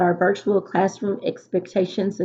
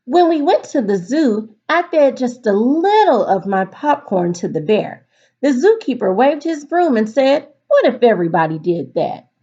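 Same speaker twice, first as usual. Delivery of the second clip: put-on lively storybook read-aloud for kids; reading to children; 4 semitones higher, 4 dB louder than usual. A narrator's reading. Teacher reading a picture book to her class, lively storytelling.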